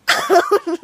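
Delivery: loud, high burst of laughter; real laugh. Real, not acted. Laughs at own story.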